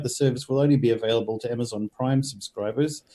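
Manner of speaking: normal talking voice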